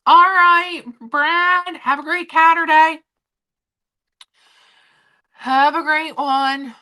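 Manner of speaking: high, loud, bubbly host voice imitating herself; playful self-parody of her on-camera voice; mock stream host greeting. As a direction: pitch it way up like a cheery streamer signing off. Quoting her own stream greeting.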